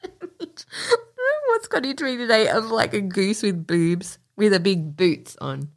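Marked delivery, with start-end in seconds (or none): 0.5-1.4 squealing laugh; high giggle. Real, not acted. Giggling fit.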